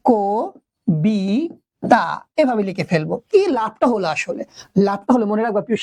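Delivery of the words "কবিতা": "কবিতা" very slowly as ko, bi, ta; syllable by syllable. Shown as a demonstration. Teacher spelling out for students, "কবিতা" slowly syllable by syllable. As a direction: drag out "কবিতা" in three separated syllables, ko - bi - ta. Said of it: "তখন আমি লিখবো".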